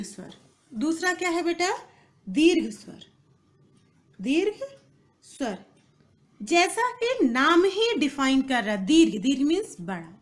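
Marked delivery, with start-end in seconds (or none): 4.2-5.6 spaced dictation. Shown as a demonstration. Teacher repeating term for students, word-by-word dictation. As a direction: dictate the two words separately.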